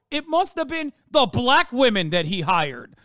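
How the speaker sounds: scornful mock voice of a critic; mocking impression. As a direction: sneer it like the people you mock.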